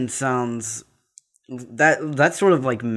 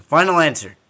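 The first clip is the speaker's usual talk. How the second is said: emphatic declaration; 5 semitones higher, 10 dB louder than usual. Real, not acted. Repeats answer louder.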